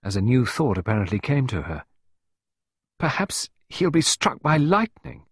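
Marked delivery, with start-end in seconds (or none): 3.0-5.3 high, slow wondering; hopeful. A narrator's reading. Pippa wondering aloud to Clarissa, hopeful. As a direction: float a hopeful new thought aloud. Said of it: "Her tone now had a hopeful note".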